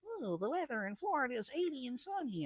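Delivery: high, quick, soft attendant singsong; mock cheery announcement. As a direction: imitate a perky cabin weather announcement. Cheery vacation weather announcement imitated.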